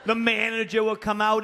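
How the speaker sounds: usual speaking voice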